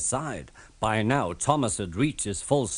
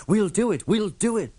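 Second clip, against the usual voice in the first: higher, plucky puffed chant; brave puffing; 9 semitones higher than usual. A narrator's reading. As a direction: puff it out with courage. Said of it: "puffed Thomas bravely".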